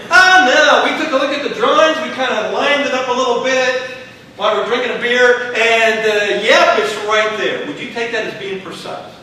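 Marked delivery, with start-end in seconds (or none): 0.1-7.7 careless builder. Retold or imitated. Careless builder brushing off concern.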